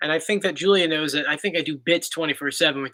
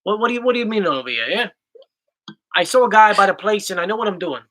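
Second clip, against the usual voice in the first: put-on South Philly Italian accent; mock Philadelphia tough-guy accent. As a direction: play a Philly wise guy squaring up. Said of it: "it's always like a tough guy".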